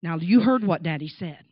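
low-voiced dad cutting off protest; firm father. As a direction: imitate a father laying down the law. Father silences child's protest.

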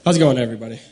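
loud greeting breaking into laughter; laughing greeting. Real, not acted.

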